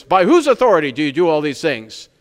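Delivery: a touch of stern Pharisee confronting Jesus. Retold or imitated. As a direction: challenge him like a stern official. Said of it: "I imagine in a very stern voice".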